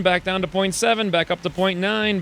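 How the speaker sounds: ordinary talk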